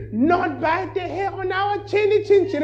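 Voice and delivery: defiant little pig up high; tiny stubborn piggy squeak; squeaky pig. Acted. Pig refuses the fox.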